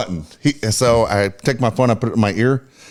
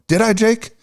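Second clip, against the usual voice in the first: lightly voiced aunt, higher pitch; imitated doubtful aunt; 10 semitones higher than usual. Acting out aunt doubting she forgot gift.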